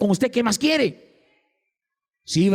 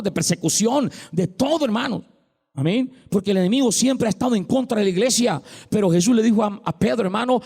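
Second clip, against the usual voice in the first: raised, impassioned sermon voice; preacher rallying his congregation; fervent preaching; 5 semitones higher than usual. Acted. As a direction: drive the point home to the church. Preaching rises again.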